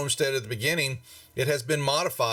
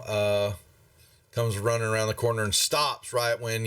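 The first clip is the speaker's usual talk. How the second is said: usual speaking voice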